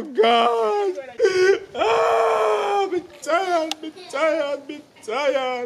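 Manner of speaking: high sobbing cry, fading tired. Acted. Worn-out man stuck in the tree, sobbing tiredly. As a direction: sob out the words, wearing down to exhaustion. Crying out, exhausted.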